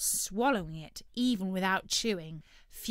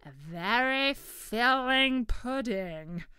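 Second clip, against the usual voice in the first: turkey voice; 4 semitones higher, 6 dB louder, slower than usual. Acted. Turkey repeats Blobbert's phrase.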